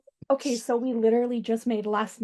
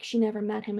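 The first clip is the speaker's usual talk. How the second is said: usual speaking voice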